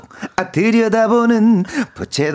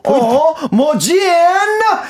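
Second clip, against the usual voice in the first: high, punchy catchphrase with a laugh; catchphrase demo; 8 semitones higher, much slower than usual. Shown as a demonstration. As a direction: show how it should land. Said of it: "약간 이렇게, 요렇게".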